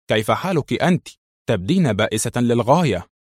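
usual speaking voice

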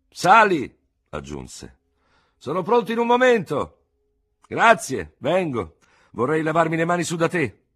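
quick exchange at the window; doctor inviting the lieutenant up; character dialogue. Acted.

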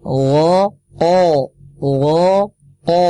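o drawn out from the roof of the mouth; demonstrating the o sound; qaf sound demo. Shown as a demonstration. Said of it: "lidah kita itu naik ke langit-langit".

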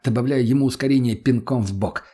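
ordinary delivery

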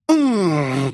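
протяжный рык без слов; прорычи, не открывая рта; рычание демонессы. A narrator's reading. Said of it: "прорычала демонесса". (рычать протяжно)

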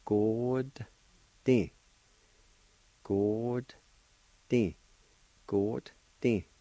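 Good and Di as two separated syllables; teacher modelling Good, Di deliberately; careful pronunciation. Shown as a demonstration. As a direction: draw out Good, pause, then short Di. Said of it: "pronounce the D sound with the G O like this".

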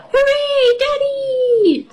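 very high excited cheer; shouting hooray. Acted. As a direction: cheer like a delighted little girl. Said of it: "shouts Peppa".

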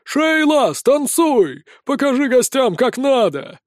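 high, eager calls; crowd egging on. A narrator's reading. Drunken fans calling on their dancer, egging her on.

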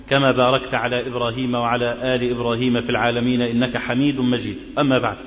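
low, even chanted prayer formula; formal liturgical chant, low and measured; khutba prayer recitation. Acted. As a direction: recite the opening praises steadily like a preacher.

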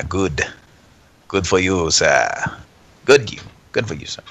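ordinary delivery

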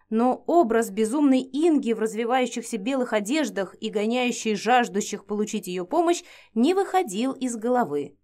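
usual speaking voice

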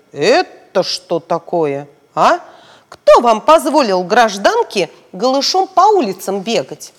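a stern policeman telling them off; stern scolding. Acted. Policeman scolding the naked girls, stern and loud. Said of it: "Он говорит".

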